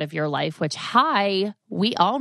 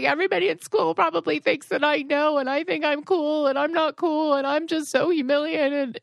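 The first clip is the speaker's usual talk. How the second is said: higher whiny tween voice; humiliated tween; 8 semitones higher than usual. Retold or imitated. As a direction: whine like a mortified kid. Totally humiliated.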